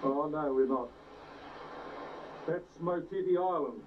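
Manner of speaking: ordinary talk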